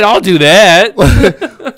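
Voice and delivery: loud, drawn-out mock eagerness; eager volunteer. Host plays a Craigslist reader taking the job, eagerly. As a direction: jump at the job, slow and loud, through a laugh.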